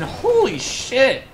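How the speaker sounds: drawn-out, high, amazed holy shit; amazed exclamation. Real, not acted. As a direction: stretch it out in disbelief. Stunned at Swedes' drinking.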